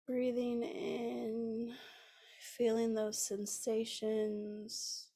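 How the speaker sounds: unhurried, soothing guide into the breath; guided meditation. Acted.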